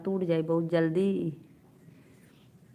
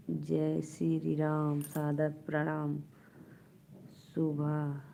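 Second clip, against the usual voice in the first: whispered, slow greeting; hushed morning greeting; soft whisper; 3 semitones lower, 7 dB quieter, slower than usual. Real, not acted. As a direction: murmur the greeting under your breath.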